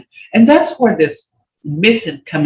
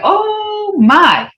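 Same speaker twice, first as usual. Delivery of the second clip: much higher exclaimed oh my; amazed oh my; 12 semitones higher, 4 dB louder than usual. Shown as a demonstration. Teacher showing the tone of amazement, crying out. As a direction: cry out oh my in wonder. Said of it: "Hear that tone?".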